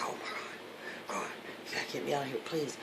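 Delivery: hushed urgent begging; whispered plea. Retold or imitated. Begging a friend to get her out, whispered. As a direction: beg softly, barely above a whisper. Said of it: "I told him in whispered tones".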